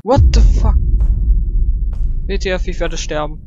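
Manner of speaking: frantic screams while fleeing; being chased. Real, not acted.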